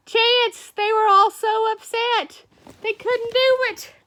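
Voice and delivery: high-pitched mock distress; mock upset. Teacher tells a pupil the bullfighters were upset, high mock distress. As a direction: pitch it up in pretend dismay.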